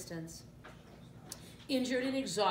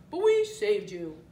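loud, hefty warthog voice; 9 dB louder, slower than usual. A narrator's reading. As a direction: speak up as the warthog. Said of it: "said the warthog".

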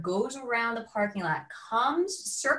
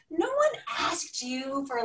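much higher, slow, pointed retort; indignant retort; 8 semitones higher, slower than usual. Retold or imitated. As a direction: spell it out slowly, offended. Retold angry retort.

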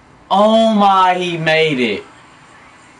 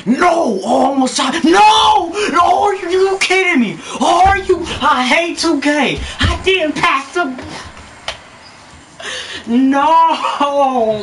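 3 yell at the screen in outrage; 4 gamer's furious rant; 1 real raging yell. Real, not acted. Raging at missed shot.

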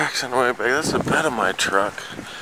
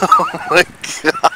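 laughing; 4 dB louder than usual. Real, not acted. Laughing in disbelief.

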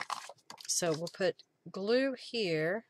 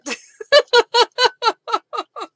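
loud, high burst of laughter; laughing; 15 dB louder than usual. Real, not acted. Crafter amused by own terrible accent, laughing hard. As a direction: crack up at yourself.

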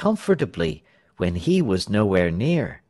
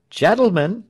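raised, high public call; oratorical address; 8 semitones higher, 4 dB louder than usual. A narrator's reading. Tell resuming his speech, oratorical. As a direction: reclaim the crowd's attention.

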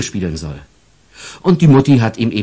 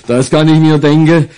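laughing; 5 semitones higher, 5 dB louder than usual. Acted. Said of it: "lachte Polizeihauptwachtmeister Prellbock".

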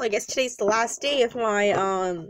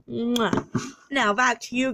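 ordinary delivery

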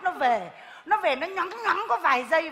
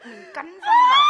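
sudden pained shout; 11 dB louder than usual. Acted. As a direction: voice jumps much higher and louder in a yelp of pain. Bitten by fire ant mid-lecture.

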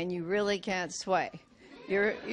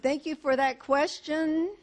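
polite firm redirect; 2 semitones higher, 7 dB louder, faster than usual. Shown as a demonstration. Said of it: "And you say".

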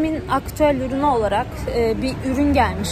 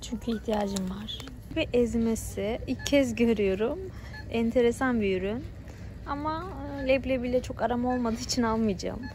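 quiet at night; 9 dB quieter than usual. Real, not acted. Talking softly inside the shop.

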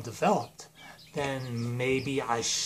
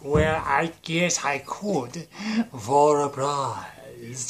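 very slow, stretched villain voice; oily, drawn-out put-on clerk voice; Space Voldemort; much slower than usual. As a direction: draw out each word like a scheming cartoon villain. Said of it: "Space Voldemort's like".

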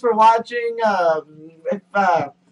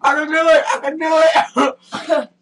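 strained self-encouragement; 8 semitones higher, 4 dB louder than usual. Real, not acted. Pushing through the challenge.

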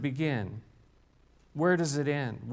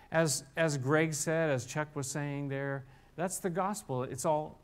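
usual speaking voice

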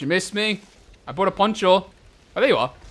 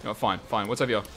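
ordinary delivery

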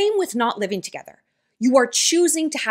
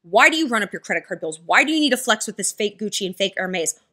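ordinary delivery

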